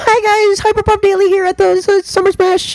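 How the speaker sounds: very high, loud squeaky presenter; hammed-up falsetto interview intro. Imitating her festival interview intro, blonde-girl voice.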